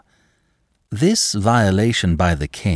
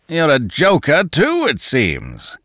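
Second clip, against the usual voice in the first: roaring; 6 semitones higher, slower than usual. A narrator's reading. Danton roars a jibe at André Louis. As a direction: bellow the jibe at him. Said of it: "roared the other".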